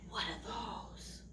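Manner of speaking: slow, hushed question; hushed suspense. Pretend. Teacher hinting at the burglars, slow and hushed. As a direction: whisper it with wide-eyed wonder. Story suspense, gasp before.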